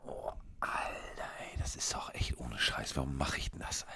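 tired whispered grumble; whispering. Acted. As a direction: breathe it out, whispered and weary. Tired early-morning moaning.

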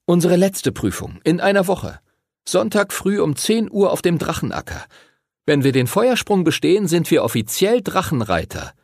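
put-on high-pitched young rider explaining; boy explaining. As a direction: explain the big test in a high voice. Voices Edward explaining the test.

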